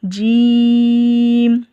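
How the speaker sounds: जीम stretched very long on the ई, no nasal sound; long drawn jeem. Shown as a demonstration. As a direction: hold जीम out for five alifs. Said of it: "नाक के अंदर आवाज़ नहीं जाएगी". (जीम को पाँच अलिफ जितना खींचकर)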